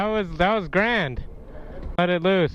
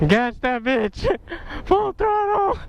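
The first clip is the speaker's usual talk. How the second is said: high hyped-up yell; excited yell; 14 semitones higher than usual. Real, not acted. Cheering full speed.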